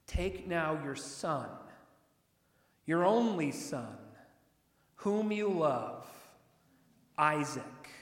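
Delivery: slow, each phrase set apart with pauses; weighty slow reading. Shown as a demonstration. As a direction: lean on every phrase of the command. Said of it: "now watch the wording here".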